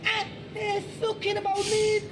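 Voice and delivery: very high-pitched, threatening Scouser; exaggerated Scouse. Shown as a demonstration. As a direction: squeal out menacing Liverpool slang. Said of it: "So here's, I think, a Scouser".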